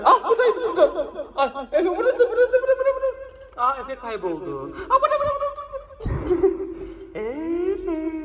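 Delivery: high, giggly peekaboo; laughing play. Real, not acted. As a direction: laugh through the words.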